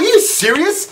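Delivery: high-pitched, rising incredulous question; indignant disbelief. Retold or imitated. Man arguing with airline agent over excess baggage, incredulous. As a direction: retell the protest, pitch shooting up.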